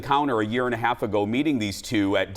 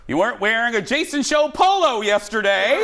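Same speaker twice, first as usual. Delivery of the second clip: quoting the producer; 10 semitones higher, 5 dB louder than usual. Retold or imitated. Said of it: "he goes".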